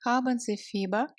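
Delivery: normal talking voice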